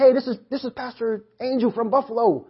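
put-on caller voice. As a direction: pretend to be a pastor phoning up. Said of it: "I'm just pretending that he talks like that".

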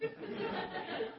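high, breathy scornful laugh; mocking scoff laugh. Simon scoffing at the rabbi, mocking laugh. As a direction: sneer out a laugh. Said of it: "Simon's going".

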